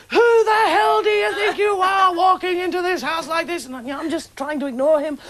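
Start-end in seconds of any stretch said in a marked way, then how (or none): 0.1-3.6 retold angry yell. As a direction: yell it back the way the stepfather did, measured and indignant. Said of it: "My stepfather is yelling at me".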